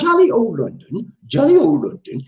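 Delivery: badly faked British accent; attempted British accent. Answering a greeting from London, shaky attempt at British accent. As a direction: have a playful go at sounding British. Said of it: "my British accent is not that good".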